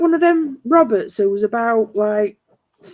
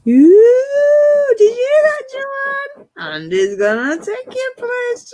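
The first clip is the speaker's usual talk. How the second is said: much higher and louder, gleeful teasing ooh; teasing delight; 12 semitones higher, 4 dB louder, slower than usual. Real, not acted. Teasing Joanne that Andy will take her places, gleeful. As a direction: egg him on in a shrill, gleeful tease. Teasing friend about Andy's offer.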